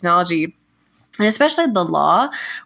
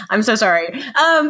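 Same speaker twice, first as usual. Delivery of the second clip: usual speaking voice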